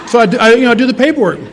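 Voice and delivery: high, loud laughing telling; laughing while telling the audience. Real, not acted. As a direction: laugh through the words.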